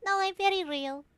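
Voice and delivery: put-on cat-girl voice. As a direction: proudly explain yourself as a cat creature. Storm the cat's character voice.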